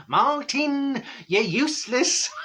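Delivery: high, drawn-out teasing call; mock scolding. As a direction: tease a colleague in a high put-on voice. Teasing colleague in retold office scene.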